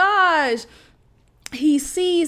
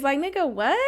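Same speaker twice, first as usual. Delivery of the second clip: usual speaking voice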